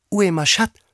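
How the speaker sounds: high and careful French question; Alice trying French. Acted. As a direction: try out your first French phrase, pitched high. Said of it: "der erste Satz in ihrem französischen Wortschatz".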